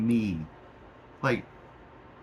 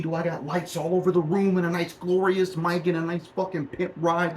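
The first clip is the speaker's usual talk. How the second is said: angry rant; 5 semitones higher than usual. Real, not acted. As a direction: rail against the accusation. Angry rant denying theft.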